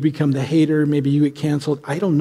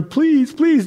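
high-pitched, urgent pleading; acted desperate plea, voice leaping up; desperate begging; 11 semitones higher, 3 dB louder than usual. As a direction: beg as if on your knees. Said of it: "You're desperate".

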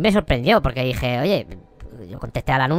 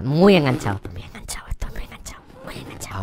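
whisper; 5 semitones lower than usual. Acted. Creepy repetition fading out.

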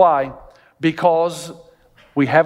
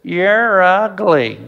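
parrot insult; 3 dB louder, much slower than usual. Retold or imitated. Parrot in the shop window taunting the passing woman, slow and drawn out. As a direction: stretch it out slowly like a rude parrot. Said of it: "and the parrot says".

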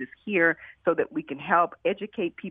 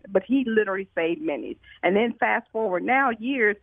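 ordinary talk

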